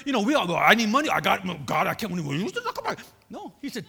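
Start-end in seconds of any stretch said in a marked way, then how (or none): 0.6-3.0 loud, hurried mock complaining; mock protest. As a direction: splutter a hammed-up protest. Acts out people protesting about money.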